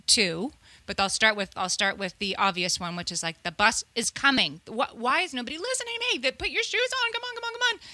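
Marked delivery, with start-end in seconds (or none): 3.4-7.8 starts normal, climbs into a high, fast nag; frantic mom hurrying kids. Acted. Mom hurrying kids as the bus comes, rising frantic nagging. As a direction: escalate to a high-pitched, rushed holler without extra volume. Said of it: "that just seems to continue".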